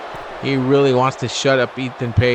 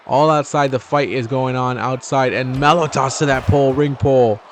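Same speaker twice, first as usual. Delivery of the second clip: ordinary talk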